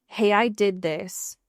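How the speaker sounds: ordinary talk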